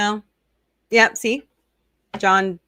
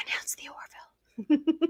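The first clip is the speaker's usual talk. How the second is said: a hushed, unvoiced whisper, higher pitched; stage whisper; 7 semitones higher, 8 dB quieter than usual. Real, not acted. Host whispering a teasing hint to her chat, hushed. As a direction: whisper the wish like a secret. Teasing aside about wished-for show.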